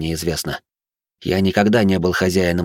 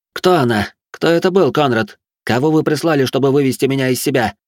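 agitated mad noblewoman; 6 semitones higher, 3 dB louder than usual. Acted. Batori near breakdown.

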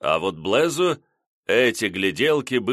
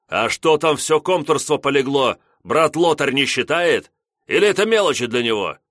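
louder, higher, faster tirade; indignant; 5 semitones higher, 5 dB louder than usual. A narrator's reading. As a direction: rant it indignantly. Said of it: "взбеленился Блесс".

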